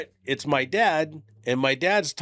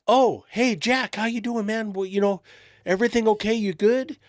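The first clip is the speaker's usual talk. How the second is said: overly friendly clerk; 10 semitones higher than usual. Retold or imitated. Guy greeting dad, high friendly voice.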